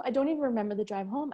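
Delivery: ordinary talk, nothing marked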